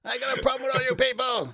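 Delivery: mock furious stand-up rant; angry comic yelling. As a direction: rant at the crowd like an enraged comic. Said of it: "Just yelling".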